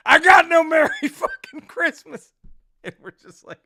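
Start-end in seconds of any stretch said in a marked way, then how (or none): 0.1-2.3 very high, loud imitated shout; hammed-up high-pitched holler; anguished heckler yell. Heckler yells on stage.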